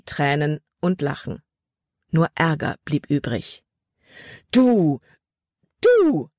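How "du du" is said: high, indignant outburst, each word on its own; sputtering anger. A narrator's reading. Libby turns on Caleb after the snow, sputtering angrily. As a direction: sputter in outrage, pitch leaping up. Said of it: "sprühte sie".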